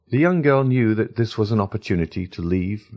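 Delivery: ordinary delivery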